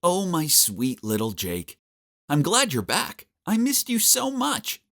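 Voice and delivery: gushing mother, pitch rising sharply; loving mom. Acted. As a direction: welcome your child back tenderly. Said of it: "words I heard from my mom".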